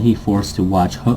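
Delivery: usual speaking voice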